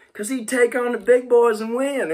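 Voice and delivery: high, bright imitation of a Southern good old boy; Southern accent. Answering his question about the Giant Slayer in a put-on Southern accent. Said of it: "he says in his Southern accent".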